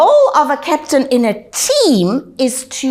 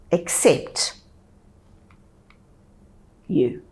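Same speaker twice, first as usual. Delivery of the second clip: slow, deliberate statement; slower than usual. Shown as a demonstration. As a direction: say it very slowly, with long dramatic pauses. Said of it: "I will say it slowly".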